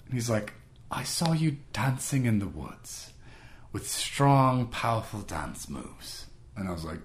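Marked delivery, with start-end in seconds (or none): 0.9-6.3 slow, drawn-out mock British accent; slow British impression, savouring each word; quirky British man. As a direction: stretch it out like an earnest Brit. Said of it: "this quirky British dude".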